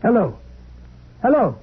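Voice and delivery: trying to get an answer from the line; calling into the phone. Acted.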